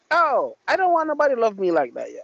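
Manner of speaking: ordinary delivery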